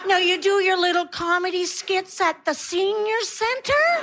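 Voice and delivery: high, slow patronizing coo; condescending praise. Acted. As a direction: humor the old dear in a sing-song high voice. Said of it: "And they go".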